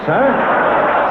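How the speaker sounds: ordinary talk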